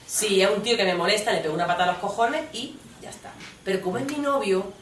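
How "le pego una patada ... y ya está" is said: ordinary talk, nothing marked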